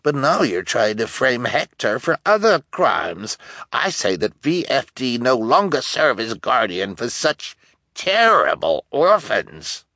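accusing elder, slowing heavily at the end; accusing town elder. A narrator's reading. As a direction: denounce the culprits before a crowd.

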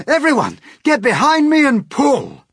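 high, very loud rallying call; rally the whole crew at a shout; urgent full-voiced command. A narrator's reading. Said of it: "shouted the old green grasshopper".

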